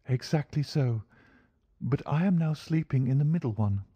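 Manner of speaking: high-pitched feminine character voice; polite young woman's high voice; lady client. Acted.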